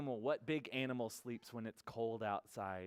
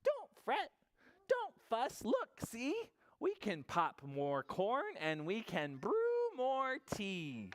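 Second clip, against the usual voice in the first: very high little mouse voice; squeaky mouse; 9 semitones higher, slower than usual. Acted. Mouse consoling the crying bear, high squeak. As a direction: squeak it as a tiny, coaxing mouse. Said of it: "Mouse squeaks".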